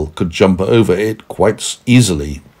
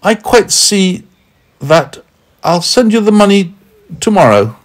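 Meddle's voice; 12 semitones higher, 3 dB louder than usual. Acted.

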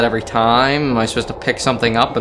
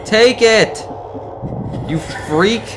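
frustrated yelling; 8 semitones higher, slower than usual. Real, not acted. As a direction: shout it over and over. Yelling at ghost girl.

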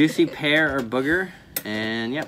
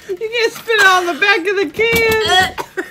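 high, loud, laughing hard; cracking up while talking; 11 dB louder, slower than usual. Real, not acted. Laughing at her partner.

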